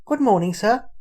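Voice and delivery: put-on high, loud, polite little girl; bright, well-mannered child greeting. A narrator's reading.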